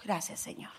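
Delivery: soft unvoiced thanks to God; breathed thanks. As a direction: whisper it in relief. Breathed thanks after God's rebuke.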